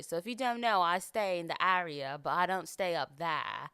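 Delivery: casual podcast chat in a put-on British accent; everyday chatter in a British accent. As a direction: keep the British accent going the whole way. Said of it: "talk in a British accent".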